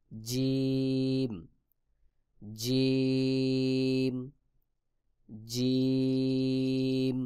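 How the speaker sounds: জিম very drawn out, middle tongue against palate; letter demonstration. Shown as a demonstration. Teacher models the letter jim, জিম stretched long. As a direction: hold the vowel of জিম on one level note. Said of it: "জিহ্বার মধ্যখান তার বরাবর উপরের তালুর".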